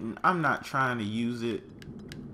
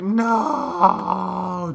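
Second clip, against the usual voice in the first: high, loud cry of no; anguished cry; 7 semitones higher, 6 dB louder than usual. Real, not acted. Player watching his friend die, anguished cry. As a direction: cry out in real dismay.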